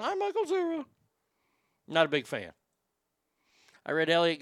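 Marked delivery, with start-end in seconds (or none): 0.0-0.9 much higher, mocking nasal-free boyish voice of Michael Cera; Michael Cera impression. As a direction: play the awkward boy, pitched way up to mock him. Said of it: "he should just go around saying".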